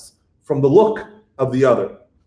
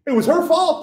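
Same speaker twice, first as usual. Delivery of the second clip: high, whiny excuse; whiny Adam; 12 semitones higher than usual. Retold or imitated. Lecturer mocking Adam's excuse, high whiny voice. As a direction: squirm out of the blame, high and petulant. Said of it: "He's like".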